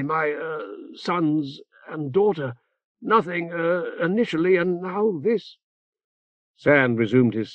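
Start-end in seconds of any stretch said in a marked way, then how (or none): 0.0-5.6 higher, halting speech full of ums and ehs; lightly voiced dithering cleric; fussy hesitant old augur. A narrator's reading. Remora continues.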